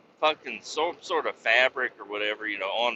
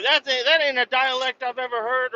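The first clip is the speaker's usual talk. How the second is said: much higher put-on voice, doubtful; suspicious horseman; 12 semitones higher than usual. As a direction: musket man doubts your dialect. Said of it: "He's like".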